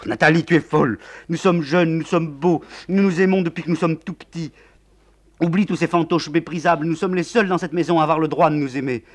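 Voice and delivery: put-on young man pleading his love; passionate young lover. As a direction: sweep her along with passion.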